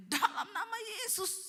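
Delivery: high, strained imitated yell; husband's cry. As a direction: cry it out as a breakthrough. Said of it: "suaminya teriak". (teriakan suami bernada tinggi)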